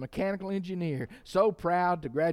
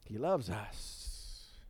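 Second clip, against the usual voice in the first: much softer, lower, very slow "He loves us"; soft tender refrain; 6 semitones lower, 8 dB quieter, much slower than usual. Real, not acted. Preacher closing the refrain to the church, hushed and slow. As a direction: let it fall to a hush. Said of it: "final refrain whispered soft".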